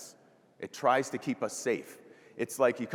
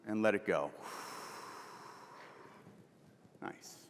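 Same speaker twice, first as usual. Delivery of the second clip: guided breathing. Acted. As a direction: ease them through the breath, calmly. Leads a breathing exercise.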